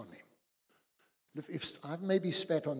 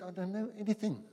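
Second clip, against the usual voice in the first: raised pitch, quick and helpless; higher, bewildered thief; 8 semitones higher, faster than usual. Acted. Thief telling the angel he knows nothing, high and quick. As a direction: protest fast and high like a lost man.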